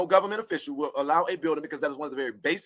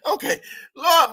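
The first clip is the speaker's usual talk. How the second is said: higher and slow, through laughter; laughing to the Lord; 12 semitones higher, 5 dB louder, slower than usual. Real, not acted. Talking to the Lord mid-laugh, laughing. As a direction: still giggling as you call out. Laughing fit right before.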